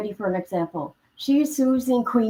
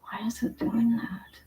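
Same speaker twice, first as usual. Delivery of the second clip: whisper; 2 semitones higher, 8 dB quieter than usual. Real, not acted. Puzzled aside during echo trouble.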